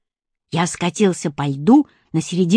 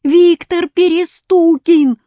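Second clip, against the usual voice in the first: high, loud, slow chorus shout; 9 semitones higher, 6 dB louder, slower than usual. A narrator's reading. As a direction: yell the name all together. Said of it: "хором ответили мальчишки".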